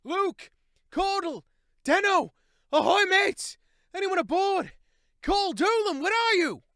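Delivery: high, loud calling out across the wreck; desperate shout for missing crewmates; shouting aloud. A narrator's reading. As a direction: yell the names of lost shipmates. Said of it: "shouted aloud".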